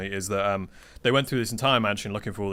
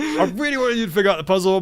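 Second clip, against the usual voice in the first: mock exasperation; 9 semitones higher, 7 dB louder than usual. GM reacts as the bard casts the spell.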